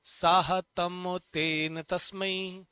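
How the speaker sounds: slow, deliberate chant of साहतम तेन तस्मै; slow recitation. Shown as a demonstration. Teacher prompting students to repeat, chanting slowly. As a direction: lead the class through it slowly. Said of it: "आंखें बंद करो और मन में ये ध्यान लाओ".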